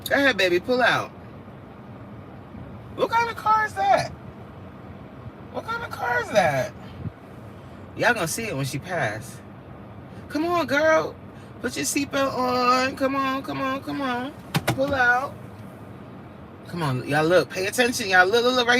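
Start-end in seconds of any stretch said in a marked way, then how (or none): 3.0-6.7 high-pitched call of what kind of car is that; curious call-out. Real, not acted. Calling out to a passing car. 10.3-15.3 higher, coaxing come on; high, eager urging to a stranger's car. Real, not acted. Urging the driver to pull out.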